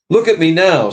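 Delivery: high loud self-display; proud boast. Acted. Big ghost boasts of himself to the spirit, loud. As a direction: thump your chest and boast. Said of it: "said the ghost, slapping his chest".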